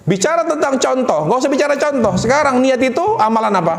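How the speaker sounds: ordinary delivery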